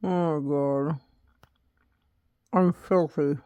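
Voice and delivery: talking with a phone between teeth; words pushed around a phone in the mouth; phone held in teeth. Real, not acted. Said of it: "I have my phone in my mouth".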